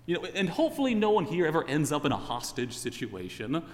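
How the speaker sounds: ordinary talk, nothing marked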